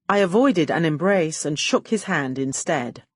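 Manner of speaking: ordinary delivery, nothing marked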